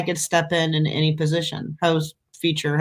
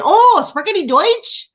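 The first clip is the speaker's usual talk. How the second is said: bright mock-eager German; 6 dB louder than usual. Answering tourists in German.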